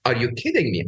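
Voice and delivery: usual speaking voice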